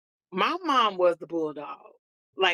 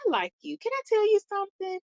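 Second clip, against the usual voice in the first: sweet mom; 11 semitones higher than usual. Retold or imitated. Mom voiced in story.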